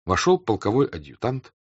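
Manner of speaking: ordinary delivery, nothing marked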